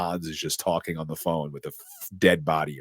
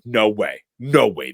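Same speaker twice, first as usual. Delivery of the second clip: annoyed; 3 semitones higher, 7 dB louder, slower than usual. Retold or imitated. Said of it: "Annoyed Deputy Dwayne is like".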